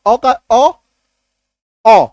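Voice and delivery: last ओ opened to "aw"; deliberate demo of ओ as "aw"; short O sound. Shown as a demonstration. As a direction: model ओ slowly as an open "aw", then repeat it alone. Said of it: "short में आपको याद रखना है".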